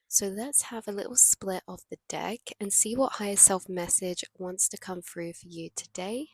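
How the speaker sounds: normal talking voice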